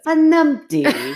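slow, high, loud Scottish; Scottish accent. Pretend. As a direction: stretch it out in Scottish. Savouring the funny word.

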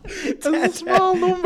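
high, laughing echo; laughing disbelief. Real, not acted. Host echoing the guest's claim in laughing disbelief. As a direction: scoff through laughter.

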